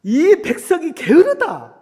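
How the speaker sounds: loud, high angry outburst, drawn out; angry Pharaoh. Retold or imitated. Pharaoh rebuking the lazy people, angrily. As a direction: thunder it like an enraged ruler. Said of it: "이제 여기서 또 화를 냅니다".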